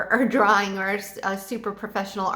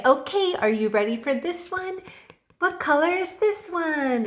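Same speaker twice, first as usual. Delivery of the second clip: enthusiastic teacher; 6 semitones higher than usual. Shown as a demonstration. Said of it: "you have to be very enthusiastic".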